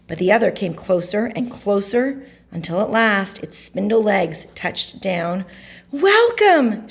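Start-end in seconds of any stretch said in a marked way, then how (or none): 5.9-6.9 high, loud welcoming call; joyful greeting. A narrator's reading. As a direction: greet long-awaited guests, pitched high. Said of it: "she greeted the men".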